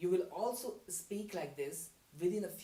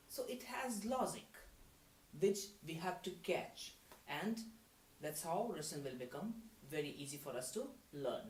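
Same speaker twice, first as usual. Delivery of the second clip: ordinary delivery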